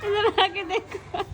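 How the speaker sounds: high, slow laughing call-out. Real, not acted. Laughing and calling someone over to see the rangoli.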